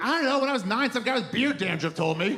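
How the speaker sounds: defensive squeak at being caught out; sheepish younger self. Retold or imitated. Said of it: "I'm like".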